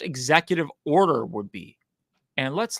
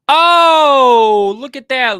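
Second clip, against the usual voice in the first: mock surprise; 7 semitones higher, 14 dB louder, much slower than usual.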